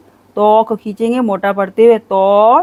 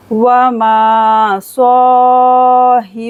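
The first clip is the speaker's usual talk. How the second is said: slow, louder tajweed chant; Quranic recitation; 4 semitones higher, 5 dB louder, much slower than usual. Shown as a demonstration. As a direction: recite it, drawn out. Recites verse. (धीमी तजवीद तिलावत)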